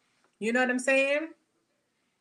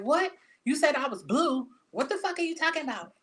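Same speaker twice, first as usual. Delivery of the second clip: mock indignant retort; 6 semitones higher than usual. Acted confused angry reply.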